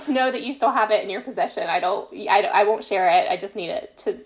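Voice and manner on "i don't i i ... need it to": ordinary delivery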